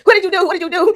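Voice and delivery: high-pitched mock accusation; retold confrontation. Man confronts her over his fiance, high mock accusing. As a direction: imitate an alarmed demand, pitched well up.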